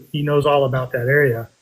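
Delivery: normal talking voice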